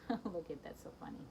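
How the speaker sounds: soft chuckling, fading to a murmur; laughing aside. Real, not acted. Reader amused by the dog photos, soft laugh. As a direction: laugh gently at the picture.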